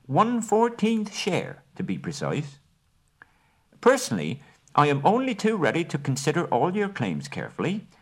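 high, precise hobbit negotiator; business manner. Acted. Bilbo bargaining with the Elven King and Bard, businesslike. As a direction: lay out terms like a careful merchant. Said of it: "in his best business manner".

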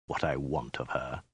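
low, hushed threat; quiet warlord menace; menacing hiss. A narrator's reading. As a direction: drop right down, soft and menacing. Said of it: "he hissed".

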